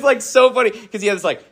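usual speaking voice

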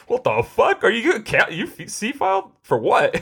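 high-pitched baffled questioning, rising at the end; mock incredulity. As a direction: question it high, in exaggerated disbelief. Voices baffled reaction to C-File.

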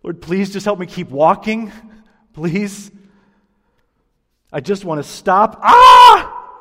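from frantic gasping up to a high, loud scream; panicked terror. Retold or imitated. Preacher voicing terrified Israelites walking between walls of water, panicked shouting. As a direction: panic mounting to a shriek. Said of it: "they're saying something like".

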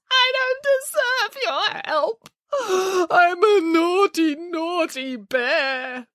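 high, slow, crying self-pity; weeping bear. Acted. Boris weeps to Nanny Piggins about being naughty. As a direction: sob out the confession like a guilty child.

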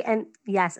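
usual speaking voice

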